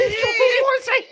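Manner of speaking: high, slow echo through laughter; laughing mock shout. Co-host echoes the passer-by's shout, laughing. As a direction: repeat the holler, cracking up.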